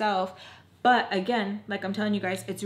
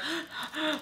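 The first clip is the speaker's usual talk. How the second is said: sharp high gasp, acting out panic; quick imitated gasp of alarm; panicked gasp; 8 semitones higher, 6 dB quieter than usual. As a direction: gasp as if freaking out. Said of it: "I'm, like, freaking out".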